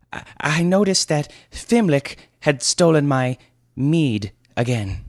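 high, loud squeaky lad's voice; stammering youth. Acted. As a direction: stammer the complaint like a boy. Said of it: "mumbled Pipkin".

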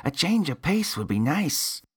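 put-on very high, nasal-free little boy voice; kid voice. A narrator's reading. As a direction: squeak it out like a small boy. Same boy continues.

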